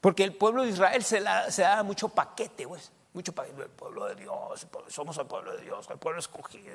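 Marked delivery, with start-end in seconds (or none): 3.6-6.7 hushed, mocking imitation of the boast; mocking smugness. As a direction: mimic a self-satisfied people.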